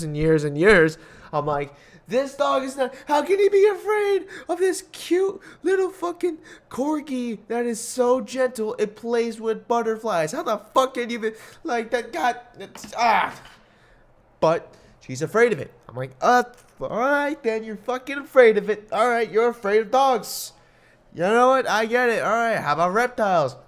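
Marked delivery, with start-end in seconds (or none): 2.1-13.4 high, strained pleading with a cry in it; higher, near-crying exasperated plea about a cute corgi. Retold or imitated. Pleading with stepmother about the dog. 16.2-23.5 exasperated bargaining. Retold or imitated. Bargaining with stepmother over pets.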